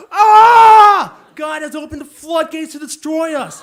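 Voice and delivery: high, full-volume scream of mock terror; panicked scream. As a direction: shriek as if doom has struck.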